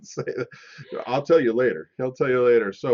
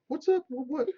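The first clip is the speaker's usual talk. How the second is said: high squeaky greeting; 13 semitones higher, 5 dB quieter than usual. Real, not acted. Greeting viewers playfully.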